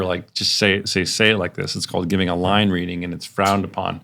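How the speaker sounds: ordinary talk, nothing marked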